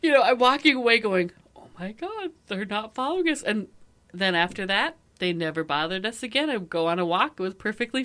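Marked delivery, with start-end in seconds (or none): 1.6-3.4 high, hushed disbelief; retold amazement. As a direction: marvel softly to yourself. Retold amazement while walking away.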